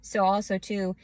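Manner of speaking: normal talking voice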